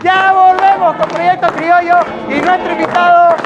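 loud, high-pitched hype send-off; big build to the guest's name; variety-show host throwing to break; show announcer. Acted.

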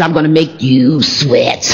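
low, slow drawn-out menace; dropped villain voice. Retold or imitated. [name] imitating Tyler threatening Captain Man, deep villain voice. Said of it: "his voice, voice drops a little bit deeper".

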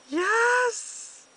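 very high, very loud yes; ecstatic shriek. Real, not acted. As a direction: shriek at the top. Jackpot moment.

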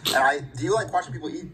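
ordinary delivery

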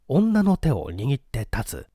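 plain reading voice

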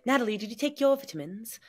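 a light hint of an English mum; parents' English accent. Natalie imitating her English parents, lightly accented. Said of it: "they say vitamins".

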